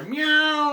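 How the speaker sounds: high, mewing cat call; mock cat meow. Retelling the film, imitating the cat's high meow. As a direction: mew it like a small cat.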